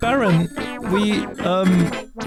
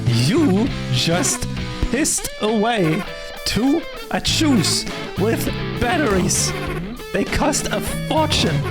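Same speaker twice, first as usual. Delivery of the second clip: higher, slow, word-by-word fury; slow outraged stress; 5 semitones higher, slower than usual. Acted. Boss repeating the loss of the wands, slow and furious. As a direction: spell out the loss one word at a time.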